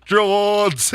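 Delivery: very high braying cry of the name; llama yelling. Retold or imitated. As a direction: bray the name like a llama in a field. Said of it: "this llama in a field going".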